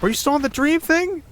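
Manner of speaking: high disbelieving squeak; can't believe she still doubts; incredulous. Acted. Exasperated at the listener still calling it a dream, high with disbelief.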